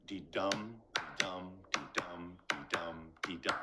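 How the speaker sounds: DEE-DUM in even pulses, each dum stressed; iambic rhythm. Shown as a demonstration. Teacher demonstrating the iambic pentameter beat, rhythmic. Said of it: "has a very specific rhythm".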